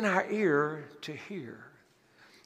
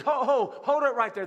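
louder, higher cry of alarm; alarmed protest; 6 semitones higher, 4 dB louder than usual. Acted. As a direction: Peter blurting out to stop him. Peter protests Jesus' crucifixion plan.

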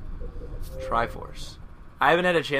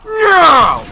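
repeated high, loud "no"; loud high wailing protest; anguished shout; 11 dB louder, much slower than usual. Real, not acted. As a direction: scream the refusal in dismay. Killed in game.

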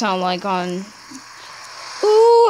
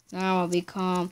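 quiet coaxing; 5 semitones lower, 9 dB quieter than usual. Acted. Soothing his Minecraft pet.

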